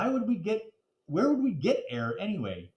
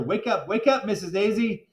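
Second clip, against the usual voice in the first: raised, higher urgent plea; urgent pleading; 7 semitones higher, 4 dB louder than usual. A narrator's reading. Kid pleads with fainted teacher, urgent and high. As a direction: call her name to wake her. Child begging teacher to wake.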